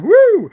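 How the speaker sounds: a high, loud party whoop; excited whoop. Real, not acted. Streamer whoops loud and high to live viewers about the fun. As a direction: let out a wild woo.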